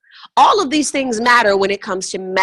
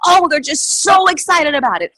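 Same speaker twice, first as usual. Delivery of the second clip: mock excitement; 7 semitones higher than usual. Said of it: "this unbelievable excitement".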